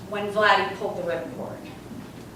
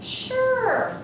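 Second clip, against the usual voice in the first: sudden high eager "Sure"; eager yes. Retold or imitated. Agreeing to her friend's plan, eager. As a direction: jump at the idea.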